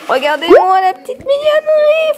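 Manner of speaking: high, loud cooing squeal; very high-pitched, loud gushing; gushing over cuteness. Real, not acted. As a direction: squeal with delight at something adorable. Showing off cute baby outfit.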